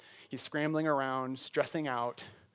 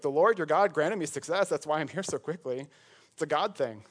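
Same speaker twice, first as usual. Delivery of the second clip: mock Christianese; 2 semitones higher, 5 dB louder than usual. Lying son excusing himself to his father, mock-pious Christianese. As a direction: lay on the church-speak to talk your way out. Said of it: "it's the Christianese he's learned to speak".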